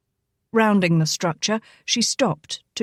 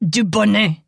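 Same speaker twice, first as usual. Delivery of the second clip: sharp, louder correction of his name; irate correction; 4 dB louder than usual. A narrator's reading. Jacques corrects the sheriff irately, louder. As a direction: snap back the right name. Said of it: "Jacques corrected irately".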